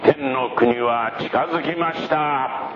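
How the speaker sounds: high-pitched prophet's proclamation; calling out. Acted. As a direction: proclaim it to a distant crowd in a high, carrying voice. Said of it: "あの大きな声で言ってる人は". (高い声で呼びかけるように)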